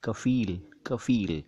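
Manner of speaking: कफील as "kafeel", plain k and f; f sound. Shown as a demonstration. Teacher repeating for learners, कफील with clear f. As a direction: say कफील with f, not ph. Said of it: "फ के नीचे है".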